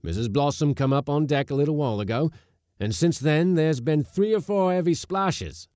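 high, loud and fast scared report; frightened sailor. Acted. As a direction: blurt out the news in alarm.

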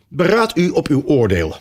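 plain reading voice

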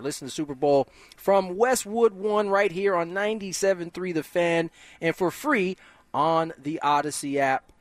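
ordinary delivery

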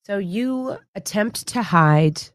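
normal talking voice

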